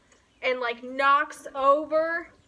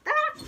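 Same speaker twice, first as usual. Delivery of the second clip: sharp surprised yelp; short scared cry; startled yelp; 13 semitones higher, 6 dB louder than usual. Real, not acted. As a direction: jump and yelp at a wasp. Sudden scare mid-sentence.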